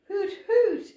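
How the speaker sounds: high hooting owl call. A narrator's reading. Tired owlet hoots goodnight to the forest. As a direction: hoot up high like a little owl. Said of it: "she cried".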